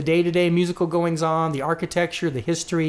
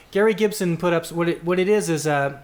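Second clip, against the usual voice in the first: ordinary delivery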